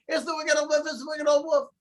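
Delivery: high mock scream of recognition. Girl recognizing the wolf, high mock scream. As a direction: shriek it once she knows him. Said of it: "she screamed out".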